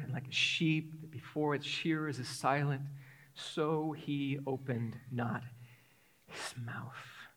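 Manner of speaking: low, soft reading fading to a whisper; solemn scripture reading. Acted. Preacher reading Isaiah's prophecy of the silent lamb, solemn and hushed. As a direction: let the verse sink into a hush.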